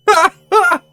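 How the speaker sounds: high, loud burst of laughter. Real, not acted. Player told he didn't save, loud high laugh.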